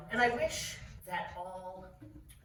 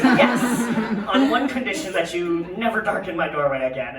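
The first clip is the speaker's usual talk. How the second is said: very loud, imperious decree; bridge troll; 3 semitones higher, 13 dB louder than usual. Retold or imitated. Endocrinologist grants the patient's request, loud and imperious. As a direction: lay down a condition like a booming troll. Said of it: "And he says".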